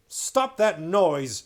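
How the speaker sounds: loud, slow, furious command; angry order. Acted. King Miraz ordering the weeping Caspian to stop. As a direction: bark it to silence him. King silencing crying boy.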